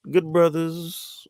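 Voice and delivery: slow, high, drawn-out promo voice; wrestler catchphrase. Retold or imitated. Host quoting The Rock's promo catchphrase, slow and drawn out.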